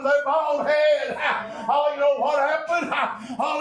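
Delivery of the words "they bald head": slow jeering children's taunt, lightly voiced; mock taunt. Preacher imitating children mocking the bald prophet, slow and jeering. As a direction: mildly mimic kids mocking. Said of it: "I'm making fun".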